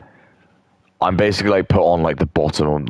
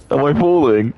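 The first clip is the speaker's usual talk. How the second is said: high, louder startled cry; startled question; 10 semitones higher, 4 dB louder than usual. Real, not acted. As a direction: yelp it as you suddenly drop. Gaming stream, sudden fall.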